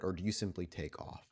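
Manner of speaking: usual speaking voice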